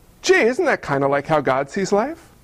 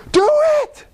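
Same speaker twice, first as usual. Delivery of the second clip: emphatic urging. Pretend. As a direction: shout encouragement. Saints urging us.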